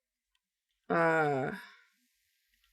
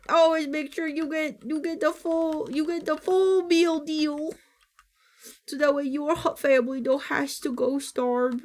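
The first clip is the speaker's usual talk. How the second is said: put-on advice voice; 7 semitones higher than usual.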